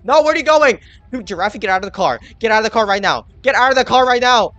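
frustrated yelling. Real, not acted. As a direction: order them out of the car. Yelling at bad driver.

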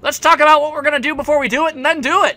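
ordinary talk

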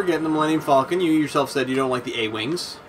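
normal talking voice

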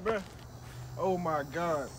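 normal talking voice

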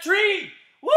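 excited game call; shouted command. Acted. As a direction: yell the command to the kids.

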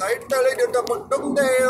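a light hint of an Indian accent, slowed; lightly voiced Indian shopkeeper accent; mild Indian accent. Store clerk Sean telling the customer the address, light Indian accent. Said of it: "he had an Indian accent".

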